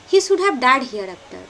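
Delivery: ordinary talk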